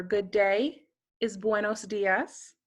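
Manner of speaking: ordinary delivery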